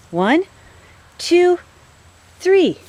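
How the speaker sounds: rising high playful count to the pet; sing-song countdown. Real, not acted.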